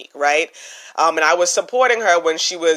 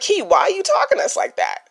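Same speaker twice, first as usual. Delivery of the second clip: much higher, incredulous "talking" stretched out; mock disbelief; 12 semitones higher than usual. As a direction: sound like a viewer who can't believe it. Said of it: "you're gonna be like".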